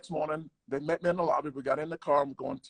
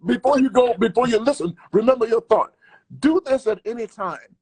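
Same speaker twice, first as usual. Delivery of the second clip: emphatic cut-in; 7 semitones higher, 11 dB louder than usual. Real, not acted. Host cuts in emphatically.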